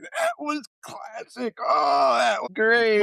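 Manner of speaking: very high, laughing through the words. Retold or imitated. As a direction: crack up while you talk. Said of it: "just busted out laughing".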